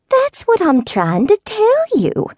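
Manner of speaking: put-on slow, high southern old-lady drawl; slow southern accent. A narrator's reading. Neighbor stalling Hope's questions, slow southern accent. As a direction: draw out the southern vowels, unhurried. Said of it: "Missus Ivy repeated".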